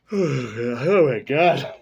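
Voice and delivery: loud, drawn-out exclamation through laughter; laughing mock cry. Retrying what people call out, laughing mock cry. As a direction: stretch it out while cracking up.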